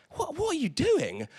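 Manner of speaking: mock bewildered wife asking what he's up to; imitating wife. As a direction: play the puzzled wife behind him. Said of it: "My wife's sat behind me going".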